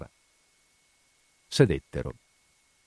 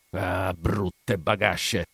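drawn-out insult; much slower than usual. A narrator's reading. Guard insults the women.

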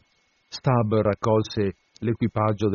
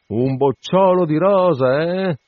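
raised, slow exclamation; crying out; 7 semitones higher, 5 dB louder, slower than usual. A narrator's reading. Sailor reacting to the stinking ship's name, crying out. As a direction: cry it out high and drawn out. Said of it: "gridò con le dita il naso".

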